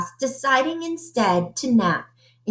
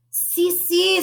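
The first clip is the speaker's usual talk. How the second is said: high, bright si si; high, eager little voice; eager puppy voice; 9 semitones higher than usual. A narrator's reading. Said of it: "said Scipio".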